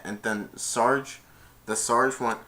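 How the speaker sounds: usual speaking voice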